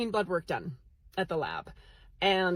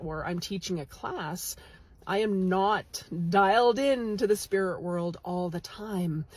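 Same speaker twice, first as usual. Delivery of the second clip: ordinary delivery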